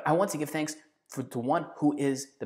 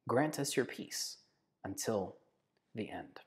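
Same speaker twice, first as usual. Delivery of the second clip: much softer, slow and fading; soft echo of prayer; 4 semitones lower, 10 dB quieter, slower than usual. Acted. Echoing the prayer to the congregation, soft and fading. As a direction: let the petition die away softly.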